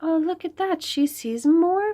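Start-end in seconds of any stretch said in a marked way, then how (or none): none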